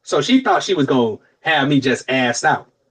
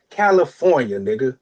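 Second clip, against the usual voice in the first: ordinary talk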